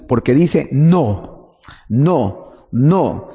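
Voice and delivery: slow, weighted repeated no; "no, no, no" drawn out slowly, each one stressed; emphatic no. Shown as a demonstration. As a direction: lean hard on each "no".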